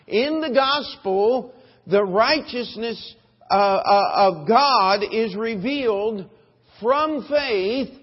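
loud, ringing pulpit voice; full-force preaching. Acted. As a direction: preach it out to the whole congregation.

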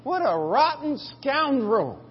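high, slow, drawn-out exclamation; retold outburst at a scoundrel; mock amazement. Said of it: "I'm sitting there going".

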